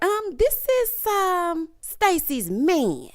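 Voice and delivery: high, slow, knowing drawl; suggestive drawl. Retold or imitated. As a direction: draw it out with a sly hint. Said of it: "why are you saying it like that?".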